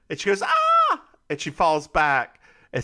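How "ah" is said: short high, loud yelp; acted high-pitched "ah"; mock falling scream. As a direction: imitate a startled scream while falling. Acts out Jess falling back.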